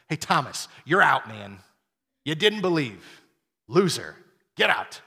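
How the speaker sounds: loud, punchy rejection; loud mock put-down; brusque dismissal. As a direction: throw him out with contempt. Imagined Jesus throwing Thomas out.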